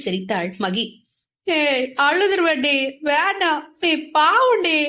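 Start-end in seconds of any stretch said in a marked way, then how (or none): 1.5-4.8 higher, pouting, mock-hurt plea; pouting whine. Boy pouts at his teasing friend, mock-hurt whine. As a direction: whine it with a pout. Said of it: "என உதட்டை பிதுக்கினான்".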